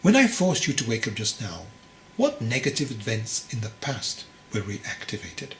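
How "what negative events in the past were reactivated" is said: usual speaking voice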